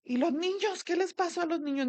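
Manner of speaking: high, alarmed questions, imitating her mother; frightened mother. Mother panicking about the grandchildren, high alarmed voice. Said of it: "bien asustada, me dice".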